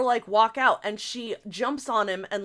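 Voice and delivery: ordinary talk, nothing marked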